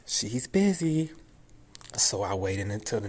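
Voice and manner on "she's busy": high, slow mimicked receptionist; overfamiliar receptionist. Receptionist making excuses to a caller, overfamiliar. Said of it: "giving me that kinda tone".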